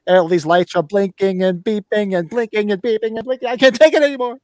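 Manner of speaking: imitated panicked rant, building to a high-pitched peak; Shatner breakdown. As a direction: quote him coming unhinged, pitch climbing line by line. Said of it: "when he was sitting, he's like".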